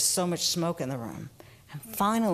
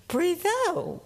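put-on child's retort; 12 semitones higher, 3 dB louder than usual. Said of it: "turns around to me and says".

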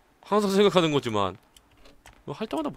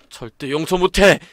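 slow angry vow; 2 semitones higher, 8 dB louder, slower than usual. Acted. Character rages.

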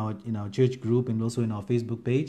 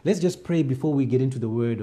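normal talking voice

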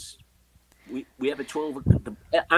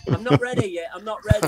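mock protest; 7 semitones higher, 7 dB louder than usual. Real, not acted. Joking about old people's home.

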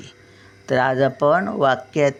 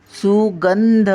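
सुगंध slowly, गं pulled high and louder; stress on सुगं; 5 semitones higher, 3 dB louder, much slower than usual. Shown as a demonstration. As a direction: hold and lift गं in सुगंध. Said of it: "कुठे आपण ओढतो?". (सुगंध मध्ये गं उंच ओढून)

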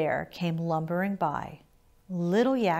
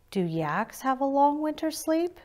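put-on higher, small child's voice; little yak voice; 8 semitones higher than usual. A narrator's reading. Little Yak asks Brown Bear about winter sleep, in a higher child's voice. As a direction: ask it as a curious young calf.

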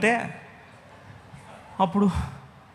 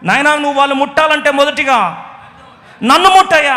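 loud, high, rising plea; pleading cry; 8 semitones higher, 8 dB louder than usual. Acted. Moses pleading with God, loud and rising. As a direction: beg with everything. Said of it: "కేకలు వేసి మొరపెడుతూ".

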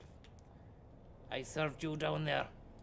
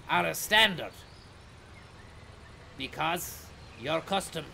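company announcement; 2 semitones higher than usual. Acted. Driver announcing the company slogan to passengers. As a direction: sell the bus company like a radio ad.

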